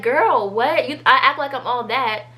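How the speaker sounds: ordinary talk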